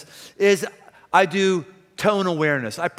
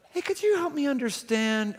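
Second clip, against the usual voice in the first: higher, soft friendly could you help me understand; invite, don't accuse; gentle request; 6 semitones higher, 5 dB quieter than usual. Shown as a demonstration. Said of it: "same exact words coming from the same person".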